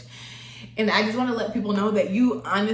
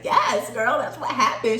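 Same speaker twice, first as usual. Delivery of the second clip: higher, louder cheering-on voice; retold hype, pitched up; hyped cousin; 3 semitones higher, 4 dB louder than usual. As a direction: imitate an excited friend agreeing. Acting out cousin's hyped reply.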